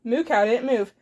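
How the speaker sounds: usual speaking voice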